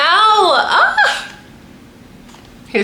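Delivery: ordinary delivery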